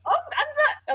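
high squeaky flustered gasp; mock embarrassed boss.

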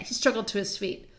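ordinary delivery

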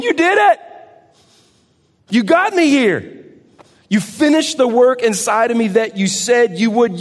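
high, loud, the second line slowed; overjoyed cry. Pretend. Believer falls before Jesus, crying out in joy.

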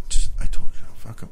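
hushed, voiceless aside; conspiratorial whisper. Retold or imitated. Friend privately reassuring his buddy, retold whisper. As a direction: whisper it on the sly, imitating a friend.

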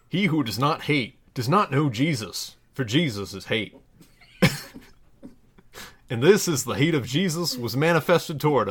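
measured, solemn Bible reading; scripture reading. Acted. Reading a parody Bible passage to listeners, solemn scripture voice. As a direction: read it like a pulpit lesson.

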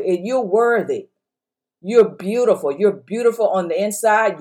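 ordinary talk, nothing marked

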